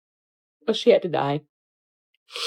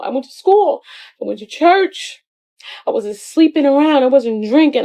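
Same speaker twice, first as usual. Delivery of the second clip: frustrated outcry; 5 semitones higher than usual. Retold or imitated. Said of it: "she's like".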